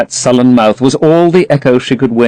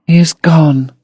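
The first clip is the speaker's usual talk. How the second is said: sad murmur; 5 semitones higher than usual. A narrator's reading. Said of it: "murmured Sybil sadly".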